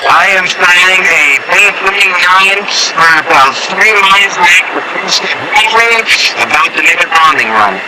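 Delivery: bomber pilot radio. Pretend. Pilot reporting a bombing run, radio effect. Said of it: "Sounds like you're in a jet bomber".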